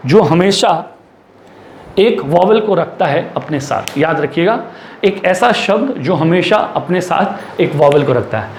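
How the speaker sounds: normal talking voice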